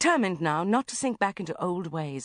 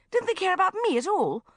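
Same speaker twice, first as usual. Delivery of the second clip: usual speaking voice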